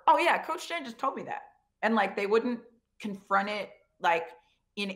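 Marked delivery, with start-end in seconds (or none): 0.1-1.4 high, bright needling jab; mock-casual put-down of the interrupting coach; teasing dismissal. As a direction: brush him off with a teasing lilt.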